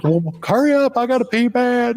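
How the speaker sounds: high mock-desperate urging; impatient passenger. Passenger urging driver to hurry.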